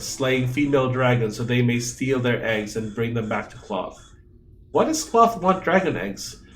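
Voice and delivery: normal talking voice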